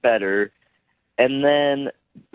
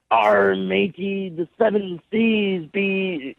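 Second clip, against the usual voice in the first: a light pirate growl, slower; 7 semitones higher, slower than usual. Retold or imitated. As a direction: arr matey, hammed-up pirate talk. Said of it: "to talk in a pirate voice".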